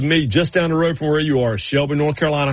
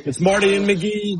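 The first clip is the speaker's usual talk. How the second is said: pitched-up, punchy radio promo read; radio announcer; 6 semitones higher than usual. Acted. Host teasing the next segment to listeners, in a hyped announcer read.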